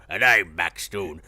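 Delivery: slow, loud gruff bald-man voice; Max Stone voice. Retold or imitated. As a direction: drag it out like a tough guy. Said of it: "Victor von Victorstein is just Max Stone".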